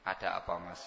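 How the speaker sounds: a wife soothing her troubled husband, soft and tender; gentle and soft. Shown as a demonstration. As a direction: ask it like a caring wife. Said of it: "bicara dengan lembut, dengan halus".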